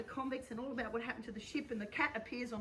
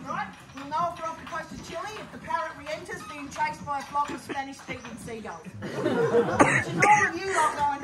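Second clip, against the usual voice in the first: lightly projected captain addressing the crowd; theatrical captain setting up the joke; stage captain; 4 semitones higher, 9 dB louder than usual. Acted. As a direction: explain it like a showman to the audience. Captain addressing audience in role.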